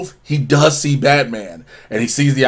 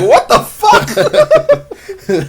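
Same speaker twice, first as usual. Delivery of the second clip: very high, stretched-out incredulous cry; comically prolonged shock; drawn-out disbelief; much slower than usual. Acted. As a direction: drag it out slowly, way up high. Two-Face's baffled reaction acted out.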